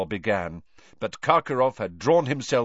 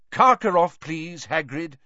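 louder, sharp, then slowing; 7 semitones higher, 5 dB louder than usual. A narrator's reading. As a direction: cut in sharply. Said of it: "said Dumbledore sharply".

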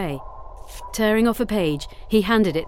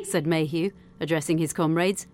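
ordinary delivery, nothing marked

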